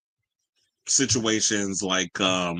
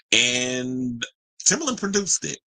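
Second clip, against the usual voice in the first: ordinary delivery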